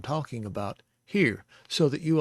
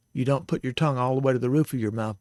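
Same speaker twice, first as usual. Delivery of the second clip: ordinary talk, nothing marked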